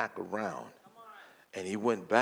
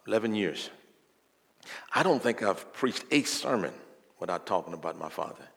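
ordinary talk

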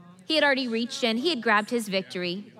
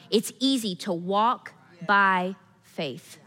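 ordinary talk

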